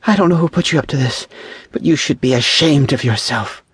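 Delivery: high, outraged reprimand; indignant scolding. A narrator's reading. Woman shaming a man over a cruel trick, indignant.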